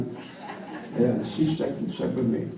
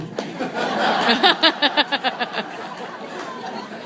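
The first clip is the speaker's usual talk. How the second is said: high hearty laugh; 11 semitones higher than usual. Real, not acted. Laughing high at his own wrestling bravado. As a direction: let the laugh ring out high and fall off.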